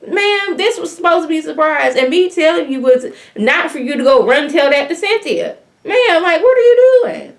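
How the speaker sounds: high-pitched, exasperated telling-off; upset scolding. Retold or imitated. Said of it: "she was upset".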